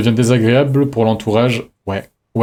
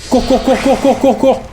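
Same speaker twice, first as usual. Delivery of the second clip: high-pitched, rapid repeated cries; frantic urging; 14 semitones higher, 3 dB louder than usual. Real, not acted. Player urging her character to run, frantic. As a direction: chant it high and fast, urging someone on.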